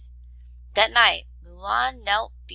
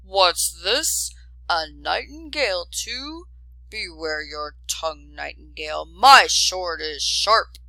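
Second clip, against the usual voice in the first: growling soldier; 4 dB louder than usual. Acted. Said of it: "the lead soldier growled".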